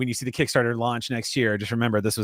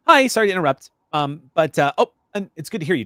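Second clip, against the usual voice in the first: higher, louder, apologetic officer on the communicator; Avrali; 6 semitones higher, 7 dB louder than usual. Acted. Science officer calling the doctor over communicator, put-on character voice. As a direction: break in brightly and apologetically, pitched up. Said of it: "you hear Avrali's voice coming in saying".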